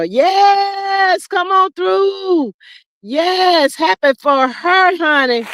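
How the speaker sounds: celebrating. Real, not acted. Host cheers a viewer picking up a new car, celebrating. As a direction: cheer them on with long, held words.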